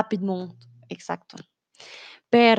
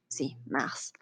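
whisper; 9 semitones lower, 13 dB quieter than usual. Real, not acted. Teacher whispers the English word.

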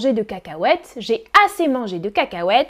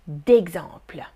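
whisper; 9 semitones lower than usual. Real, not acted. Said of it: "whispered aside".

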